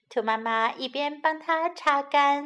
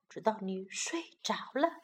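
plain reading voice

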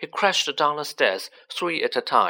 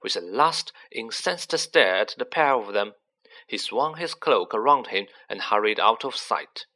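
ordinary delivery

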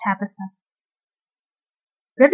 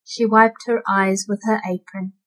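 ordinary delivery

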